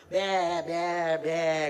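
bleating like a frightened sheep. Acted. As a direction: bleat the word like a lamb. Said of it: "लागलं ओरडायला". (मेंढरासारखं ब्या ब्या ओरडणं)

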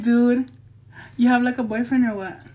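normal talking voice

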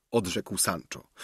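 ordinary delivery, nothing marked

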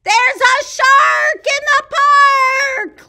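high, loud, slow panicked yell; mighty yell. Acted. Boy warns everyone about a shark, mighty yell. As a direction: yell with all his might. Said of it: "yells with all his might".

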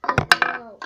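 loud breathy oh no; dismay. Acted. Character loses helmet, loud dismay. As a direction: gasp it out in dismay.

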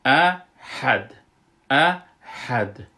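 احد slow and loud, two clear syllables; احد drawn out in recitation style; correct ahad. Shown as a demonstration. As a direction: stretch out احد with a firm final ha and dal.

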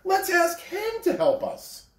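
high, bright clown voice; funny little clown. Acted. Toy clown spotting the shiny engine, high and excited. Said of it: "said the funny little clown".

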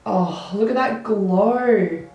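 normal talking voice

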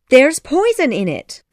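high, loud fairy outburst; urgent warning. Acted. Tink warns Peter about the medicine, high and urgent. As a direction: cry out a warning.

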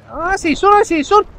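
high, loud burst of excitement; real thrill of spotting the target; excited shout. Real, not acted. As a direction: yell it out as you spot him. Spots enemy in shooter game.

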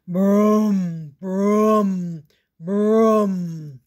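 loud booming brum of a giant; giant footsteps. Acted. Giant father coming to the kitchen, loud booming. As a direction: boom each brum like heavy steps. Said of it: "David's father sounded like this".

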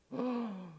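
sharp, high gasp; panicked gasp. Retold or imitated. Boy who has lost dad's tackle box, gasping in panic. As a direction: gasp in dawning horror.

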